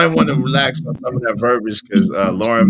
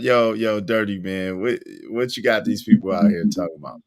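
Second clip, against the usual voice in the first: normal talking voice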